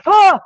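short, high, very loud yelp; comic scream of horror; mock horrified scream. Hotel viewer hearing a random voice, mock horrified scream.

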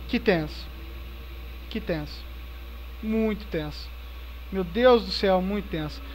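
soft, low tense murmur; tense hushed voice. Real, not acted. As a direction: murmur quietly, on edge. Tense hiding moment in game.

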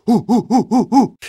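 high, short hoots; quick high whooping; rhythmic hooting. Acted. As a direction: hoot along like a rowdy audience. Hooting along after the chant.

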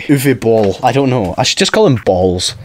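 usual speaking voice

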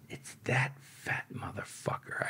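slow, whispered curse; very soft, drawn-out whisper; hushed retold realization. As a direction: whisper it slowly, as if it just sank in. Retold hushed realization about the informer.

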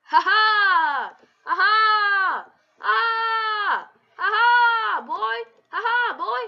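put-on high loud chanted ha ha boy; gloating taunt. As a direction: crow over the enemy you just took. Gloating chant after kills.

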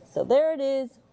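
plain reading voice

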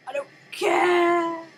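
loud, high, stretched-out wail; exasperated shout. Real, not acted. Player who did it too early, exasperated drawn-out shout. Outburst after mistimed move.

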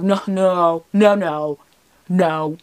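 loud, emphatic string of noes; firm refusal. Retold or imitated. Girlfriend refusing her boyfriend's hair-tie proposal, loud insistent refusal. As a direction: shut the idea down loudly, re-enacted.

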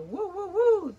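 high, loud little whoops; excited cheer. Real, not acted. Vlogger cheering for the box makers Trudy and Pam, high and loud. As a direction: cheer like a fan at a party.

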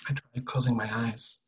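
ordinary delivery

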